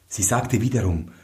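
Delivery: usual speaking voice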